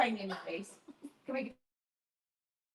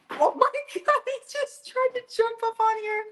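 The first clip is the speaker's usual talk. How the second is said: much higher, startled outcry; startled exclamation. Real, not acted. Owner startled as the dog jumps up onto the table, high outcry. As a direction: gasp it out as the dog leaps up.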